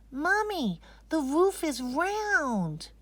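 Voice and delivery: put-on high, slow, wide-eyed child voice; little girl voice. Anisa arriving at the masjid, amazed little girl.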